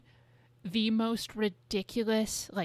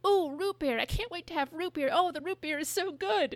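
put-on higher, gushing little kid; mock kid voice; 6 semitones higher than usual. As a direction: play a kid thrilled by root beer. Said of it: "a bunch of kids running by going".

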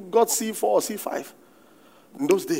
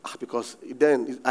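ordinary talk

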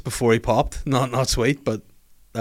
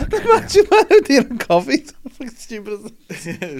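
laughing; 11 semitones higher, 9 dB louder than usual. Real, not acted. Laughing high mock announce.

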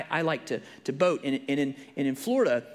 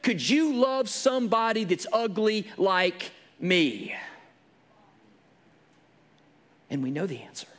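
higher, louder revival preaching; preaching at full force; 8 semitones higher, 4 dB louder than usual. Acted. Preacher retelling the woman at the well, fervent. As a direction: drive the story home to the pews.

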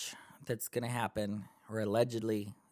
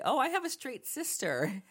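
mock sweet reply; 11 semitones higher than usual. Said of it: "I'm gonna say".

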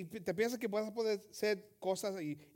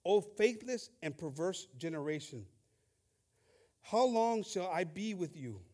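a solemn scripture read; 4 semitones lower than usual. Acted. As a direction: read the verses with weight. Reading scripture aloud.

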